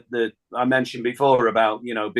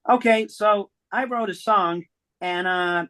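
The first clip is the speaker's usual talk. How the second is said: higher put-on voice for Diane on the phone; imitating Diane; 5 semitones higher than usual. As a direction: play Diane, lifted and bright in pitch. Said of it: "She said".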